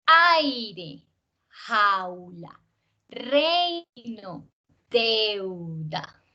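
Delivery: stressed open vowel; diphthongs. Shown as a demonstration. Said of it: "tiene que seguirse manteniendo al ladito".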